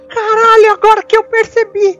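high, amazed exclamation; sudden realisation. Real, not acted. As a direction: blurt it high as it dawns on you. Real sudden realisation, others laugh.

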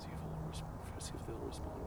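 soft unvoiced whisper. Real, not acted. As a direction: whisper so the animals can't hear. Quiet at night near wildlife.

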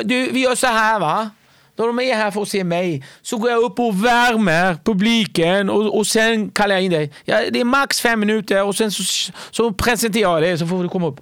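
high, loud, smug headliner laying down the plan; Wahlbeck impression. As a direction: pitch up and sound condescendingly generous. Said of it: "Han bara". (hög, högljudd och nedlåtande Wahlbeck-röst)

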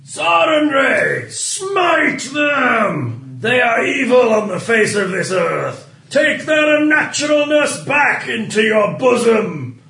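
booming zealot's invocation. Acted. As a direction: roar the prayer at the heavens. Cleric invokes sun goddess.

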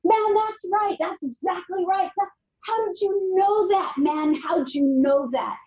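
astonished stranger crying out in the bar; retold excited yell. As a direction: squeal it out like a stunned bar patron. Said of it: "he starts screaming".

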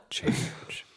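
hushed slogan reveal; soft mock-advert whisper; mock campaign whisper. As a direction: whisper the tagline, drawn out.

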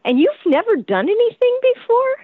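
acted-out impressed disbelief; amazed. Casting lady to untried actress after her reading, amazed.

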